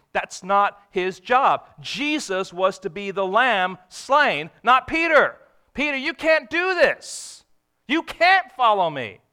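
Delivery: loud, slow, emphatic preaching; preaching at full force. Acted. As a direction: drive the point home to the congregation.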